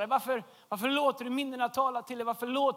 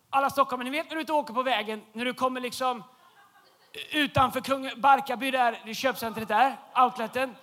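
ordinary talk, nothing marked

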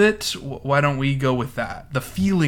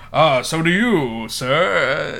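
slow, louder, haughty retort; arch comeback; 4 semitones higher, 7 dB louder, much slower than usual. Retold or imitated. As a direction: drawl it out coolly. Edna's retort in banter.

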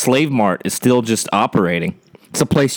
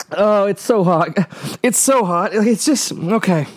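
strained and pitched up from the heat; burning mouth; 5 semitones higher than usual. Real, not acted. Host on a podcast reacting to a scorching hot sauce, strained. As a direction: choke the words out, mouth on fire. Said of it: "Oh, my lips are burning".

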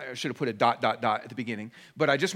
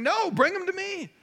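Jesus welcoming; 14 semitones higher than usual. Retold or imitated. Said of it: "Jesus saying".

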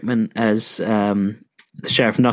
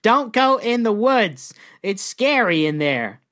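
slow put-on voice, starting high; mock woodland warning; 6 semitones higher, slower than usual. Outlaw warning about the woods, mock slow warning. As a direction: stretch out a hammed-up warning.

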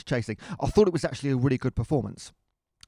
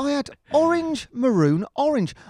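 higher, louder, ticking off each colour in disbelief; amused disbelief; 11 semitones higher, 4 dB louder than usual. Real, not acted. Telling co-host about his outfit, rising amused disbelief. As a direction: spell out the embarrassing outfit, piece by piece. Listing clashing kit colours.

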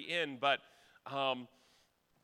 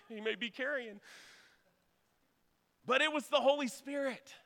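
higher, louder preaching voice; 8 semitones higher, 7 dB louder than usual. Acted. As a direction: urge the congregation with fervour. Preaching at full force.

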